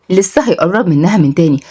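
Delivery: ordinary delivery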